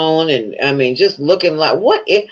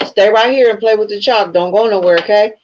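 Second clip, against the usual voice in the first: mock stern parent; 5 semitones higher than usual. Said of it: "So I'ma tell 'em".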